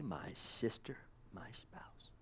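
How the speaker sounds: hushed, whispered endearment; intimate whisper. Retold or imitated. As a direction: let it fall to a tender whisper. Voicing bridegroom's tender address.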